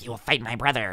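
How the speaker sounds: lightly voiced little gremlin, low; a hint of Smeagol; gremlin kid. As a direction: imitate a creepy little gremlin's challenge. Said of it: "six-year-old gremlin looking like Smeagol".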